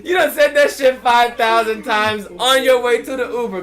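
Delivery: loud, high exasperated callout; laughing, exaggerated disbelief at full volume; incredulous rebuttal. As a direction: call out an obvious lie loudly.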